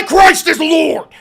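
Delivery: slow, high, very loud preacher yell; hammed-up Bible-thumper scream; mock zealot bellow. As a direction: roar it like a furious street preacher. Mocking religious commenters.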